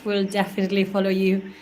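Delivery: ordinary talk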